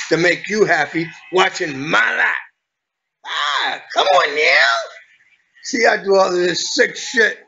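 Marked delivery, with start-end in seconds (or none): 3.3-4.8 shrieking come on now; outburst. Acted. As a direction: yelp it out in a clownish squeal. Sudden outburst in clowning.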